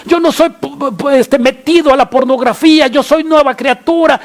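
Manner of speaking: high, urgent pleading prayer; impassioned acted confession; crying out to God. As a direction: pour out anguish in a high, insistent voice. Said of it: "estamos nosotros clamando".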